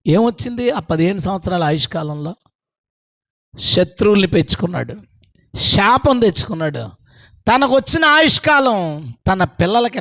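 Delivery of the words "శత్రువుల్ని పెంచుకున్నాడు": ordinary delivery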